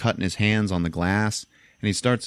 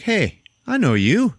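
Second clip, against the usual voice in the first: high, slow recognition; 12 semitones higher, 5 dB louder, slower than usual. Retold or imitated. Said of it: "and he's like".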